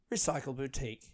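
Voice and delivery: Recycle Boutique soft and falling; train announcer. Acted. Comedian mimicking the train stop announcement, falling announcer. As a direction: announce Recycle Boutique as arrived, dropping at the end. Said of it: "it's like on the train".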